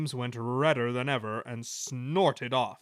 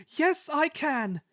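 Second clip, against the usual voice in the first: much higher, slow, triumphant resolve; brave; 5 dB louder, slower than usual. Acted. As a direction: shout your courage up the hill. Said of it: "he puffed bravely".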